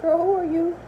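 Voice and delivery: put-on high, baffled "Girl, who are you?"; puzzled who-are-you. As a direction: stare at a stranger you don't recognise. Pretending not to know sister.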